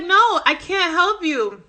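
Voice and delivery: high, drawn-out retold protest; acted exasperated refusal. Clerk turning down pleading customer, exasperated refusal. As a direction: push back slowly, stretching the words.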